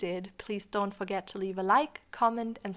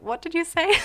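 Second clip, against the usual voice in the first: high, louder imitation of puzzled children; puzzled kids; 8 semitones higher, 5 dB louder than usual. Host kids failing to understand her, high and puzzled. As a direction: put on a high little-kid question.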